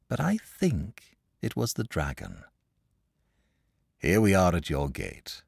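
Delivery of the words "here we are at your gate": matter-of-fact announcement; understated brusque man; abrupt. A narrator's reading. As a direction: break off the tale and state it. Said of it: "said the man abruptly".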